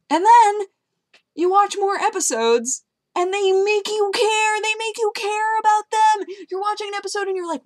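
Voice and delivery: high, loud, exasperated rush of excitement; worked-up outburst. Real, not acted. Telling viewers the episodes make you care, worked-up outburst. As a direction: build to a high, loud plea on caring. Worked up about caring for ponies.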